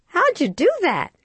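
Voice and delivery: high, loud astonished question; can't believe what she just saw; bewildered. A narrator's reading. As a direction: friend amazed at the signing toddler, high and loud.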